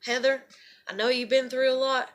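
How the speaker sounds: low, soft, reassuring offer of care; imagined caring friend. Pretend. Imagined friend at the door promising care, soft reassurance. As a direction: gently reassure as the friend who never came. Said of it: "nobody comes knocking on the goddamn door and is like".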